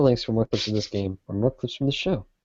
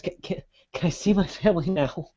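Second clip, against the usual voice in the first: higher, halting, fearful plea; more scared; 6 semitones higher, 6 dB quieter than usual. Acted. Captive begging to see his family, scared. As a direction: stammer it out like a frightened captive. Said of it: "I feel like you should sound more scared".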